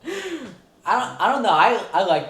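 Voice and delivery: loud and high, getting carried away; real, excited over-loud talking; nearly yelling. Real, not acted. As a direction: raise your voice without meaning to. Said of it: "sorry, am I yelling".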